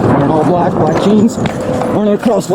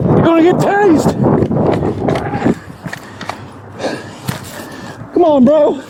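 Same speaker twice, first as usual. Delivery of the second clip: shouting; 8 semitones higher, 3 dB quieter than usual. Real, not acted. Officer warning fleeing suspect.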